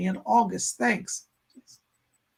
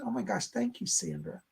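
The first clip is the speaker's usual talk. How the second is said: ordinary delivery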